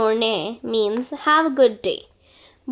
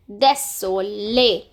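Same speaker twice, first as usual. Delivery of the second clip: Désolé slowly, ending on a clear lé; slow model word; 3 semitones lower, slower than usual. Shown as a demonstration. Teacher models the full word slowly. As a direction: model Désolé at half speed. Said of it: "Now this is pronounced as lé".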